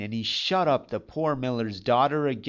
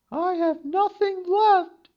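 high maiden voice; 5 dB louder than usual. A narrator's reading. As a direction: whisper it like a frightened young girl. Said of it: "said she".

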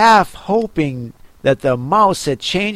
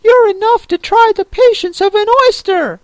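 put-on much higher, louder snappy young crab; snappish young crab; 5 dB louder than usual. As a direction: snap at your mother. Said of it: "a little snappishly".

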